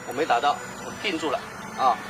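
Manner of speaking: normal talking voice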